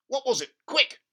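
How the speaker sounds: high, loud and urgent; an eager dad, high and loud; frantic father. Acted. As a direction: demand it fast. Father urging.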